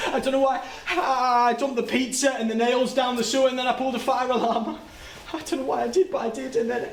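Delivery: high, breathless rush of fear; words tumbling out in panic; panicked retelling. Acted. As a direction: relive the frantic escape, pitched high. Relives fleeing the scene in panic.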